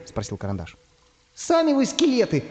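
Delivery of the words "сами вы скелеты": high-pitched indignant comeback; affronted, voice jumping up high; offended retort. Acted. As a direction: throw it back, stung. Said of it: "обиделся первый голос".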